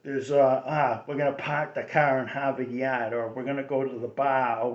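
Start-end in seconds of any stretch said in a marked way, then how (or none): 0.6-3.1 dropped r Boston accent, car and yard without r; lightly done Boston working-class accent. Shown as a demonstration. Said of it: "there's two accents in Boston". 3.3-4.6 Boston accent, bar with no r. Shown as a demonstration. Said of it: "there's two accents in Boston".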